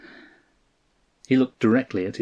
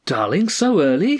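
high, louder burst of greeting; delighted cry; 7 semitones higher, 5 dB louder than usual. A narrator's reading. Wife greets husband home early, crying out high. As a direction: cry it out high, in pleased surprise. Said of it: "she cried".

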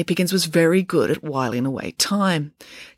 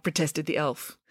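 usual speaking voice

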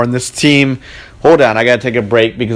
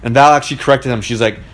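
normal talking voice

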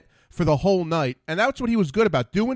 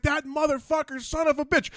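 imitated cursing; 9 semitones higher, 3 dB quieter than usual. Host cursing through the commercial break, mock angry. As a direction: rant off-air in a raised pitch. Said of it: "he's cursing".

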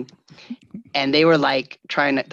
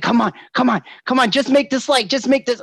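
higher, louder urging; impatient driver urging, pitched up; road-rage urging; 10 semitones higher, 3 dB louder than usual. Retold or imitated. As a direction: push to make the light. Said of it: "It was always like".

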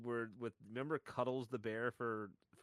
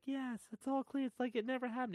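voicing the bear; 13 semitones higher than usual. Telling a friend about the parody ad, squeaky bear voice. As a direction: whimper it like a traumatized stuffed bear.

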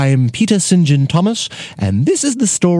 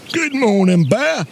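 higher-pitched put-on hunter voice; storybook hunter; 7 semitones higher than usual. A narrator's reading. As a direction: answer curtly as the hunter. Hunter answers the bear.

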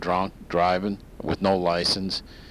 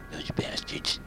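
unvoiced whisper aside; 8 dB quieter than usual. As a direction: whisper the insult behind a hand. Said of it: "President Clinton".

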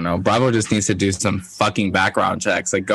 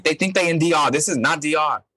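impression of my mom; 7 semitones higher than usual. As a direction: scold like an annoyed mom. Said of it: "my impression of my mom".

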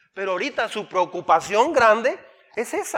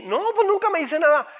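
higher, louder exasperated complaint; complaining parent; 9 semitones higher, 5 dB louder than usual. Retold or imitated. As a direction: whine that the kid never talks. Parent complains about silent child.

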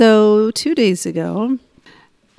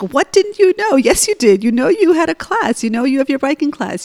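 higher, louder scolding, speeding up; retold yelling back; 6 semitones higher, 3 dB louder than usual. Mother yelling back at her crying son, angry. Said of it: "And I yelled back".

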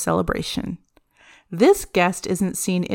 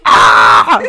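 disgusted shout; 9 semitones lower, 11 dB louder than usual. Real, not acted. Disgusted outburst.

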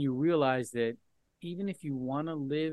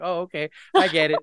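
loud, mock sheepish oh okay; retold realization; 13 dB louder than usual. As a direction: blurt it out loud like the joke just landed. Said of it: "And I was like".

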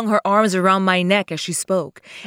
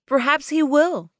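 higher-pitched, understated bitterness; bitter; 8 semitones higher than usual. Acted. Woman answering the lover she is giving up, bitter. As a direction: say it with a touch of bitterness. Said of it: "she said bitterly".

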